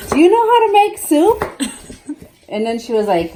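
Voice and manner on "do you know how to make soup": higher and louder incredulous question; exasperated. Retold or imitated. Mother retelling scolding sister, incredulous and loud. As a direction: disbelief at the little sister's soup. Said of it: "So I was like".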